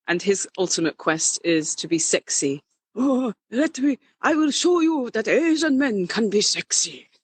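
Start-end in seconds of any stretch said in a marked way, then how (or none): 3.0-7.1 higher, hammed-up wise old master; old kung fu master spoof. Drag king performs his old kung fu man spoof, higher. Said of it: "And he speaks like this".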